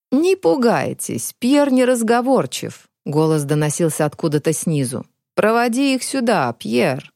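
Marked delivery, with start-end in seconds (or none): 0.1-2.9 put-on high, slow, cracked old man. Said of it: "надтреснутый старческий голос". 5.3-7.1 put-on higher cracked old man. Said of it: "надтреснутый старческий голос".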